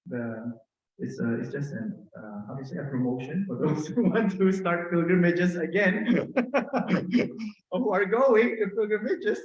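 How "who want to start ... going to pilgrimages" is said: higher, louder, breaking into real laughter; amused plug dissolving into laughs; laughing. Real, not acted. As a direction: joke through your own laughter. Joking plug for pilgrimages.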